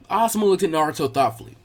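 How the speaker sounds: ordinary delivery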